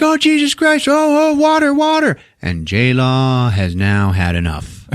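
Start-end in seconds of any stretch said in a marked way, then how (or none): 0.0-2.2 very high, hammed-up panicked cries; mock panic. Couple as the pipe floods the room, mock panic. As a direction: squeal in mock alarm.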